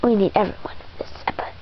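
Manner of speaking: fast hushed whisper. Real, not acted. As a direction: whisper it quickly to the team. Whispered aside during play.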